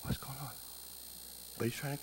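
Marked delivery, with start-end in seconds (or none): 0.0-0.5 very soft, puzzled inner voice; quiet bewildered wondering. Retold or imitated. Husband wondering over his sobbing wife, soft and puzzled. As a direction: murmur it to yourself, lost. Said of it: "he's wondering".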